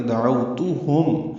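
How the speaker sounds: دعوتہم slowly, with a clear تا; careful tajweed reading. Shown as a demonstration. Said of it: "تا کو معروف طریقے سے پڑھیں گے،". (دعوتہم آہستہ، تا معروف طریقے سے)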